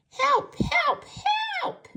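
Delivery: high, loud piglet squeal for help. Acted. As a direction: squeal it like a frightened little pig. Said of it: "he will squeal for his mother".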